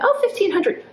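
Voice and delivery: high, hopeful mishearing; mock-naive surprise at the price; hopeful misunderstanding. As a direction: chirp it up, hoping it's cheap. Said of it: "And I'm like".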